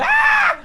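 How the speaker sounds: sudden high yelp of alarm; retold scream. Mate on the bank screaming in alarm, a sudden high shriek. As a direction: shriek out the fright. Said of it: "a Graven lets out a scream".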